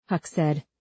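ordinary delivery

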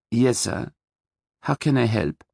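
lightly voiced German woman offering help; soft-spoken German accent. A narrator's reading. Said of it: "spoke in a soft German accent".

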